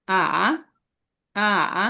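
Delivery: আ dipping down then rising. Shown as a demonstration. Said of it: "নিচে নেমে উপরে উঠবে?". (আ নেমে আবার উঠবে)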